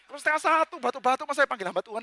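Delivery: high-pitched comic grumbling; exasperated inner voice. Acted. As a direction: whine in disbelief about a midnight call-out. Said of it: "Dalam hati".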